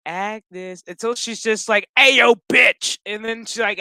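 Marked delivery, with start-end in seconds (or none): 0.1-0.8 softly acted summons; soft coaxing call. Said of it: "like, soft". 2.0-3.0 loud, brash wake-up call; mock yell. Gives up soft calling, yells.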